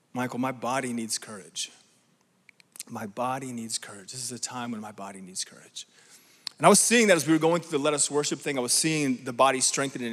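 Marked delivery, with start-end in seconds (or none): 0.1-4.1 hushed. Retold or imitated. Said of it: "I felt like the Lord said". 4.1-5.9 whispered voice of the Lord. Retold or imitated. The Lord whispering to Michael about the church, hushed. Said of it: "I felt like the Lord said".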